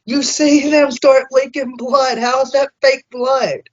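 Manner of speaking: riled up, calling out the doubters, ends shouting; heated rant. Retold or imitated. Heated rant about fake blood claims.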